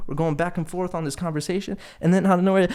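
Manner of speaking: ordinary delivery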